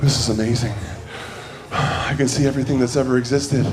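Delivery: low, soft, wonder-struck; awed hush. Retold or imitated. First astronaut seeing Earth, hushed awe. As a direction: gaze at Earth in quiet awe. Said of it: "was like".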